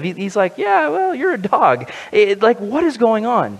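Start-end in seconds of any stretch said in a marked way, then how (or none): 0.6-1.9 high-pitched mock brush-off; dismissive. Preacher imitating Jesus' reply to the woman, mock dismissive. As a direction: wave her away in a higher, put-on voice. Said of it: "it sounds so dismissive".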